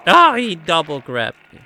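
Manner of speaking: loud, high frustrated shout; frustrated yell. Real, not acted. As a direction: yell it out in dismay. Grabbed by enemy.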